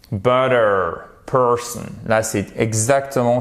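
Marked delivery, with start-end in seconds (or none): 0.1-1.8 Butter and Person with full English r; clearly sounded R. Shown as a demonstration. Teacher showing ER always sounds alike, clearly sounded R. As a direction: hold the r colouring in Butter and Person. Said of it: "on a toujours le R".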